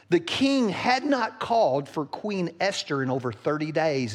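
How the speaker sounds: ordinary delivery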